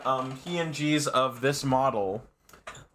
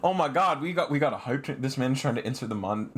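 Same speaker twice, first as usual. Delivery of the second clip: usual speaking voice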